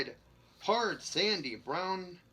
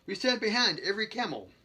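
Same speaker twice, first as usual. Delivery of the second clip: joking boast, higher and louder; 6 semitones higher, 6 dB louder than usual. A narrator's reading. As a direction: proclaim it like a mock slogan. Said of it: "Maskelyne joked".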